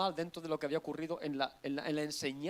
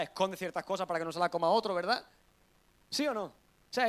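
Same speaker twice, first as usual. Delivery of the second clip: ordinary talk, nothing marked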